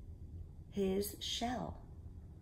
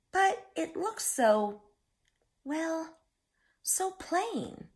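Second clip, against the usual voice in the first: much higher, slow, dismayed crab voice; disappointed hermit crab; 11 semitones higher, 5 dB louder, slower than usual. Acted. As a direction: sound let down by the plain shell. Crab judging his plain shell.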